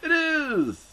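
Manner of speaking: very high-pitched playful squeal; gleeful squeal. Pretend. Host echoes co-host about wrestling trivia, gleeful squeal. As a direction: squeal it in mock delight. Mock-excited echo of co-host.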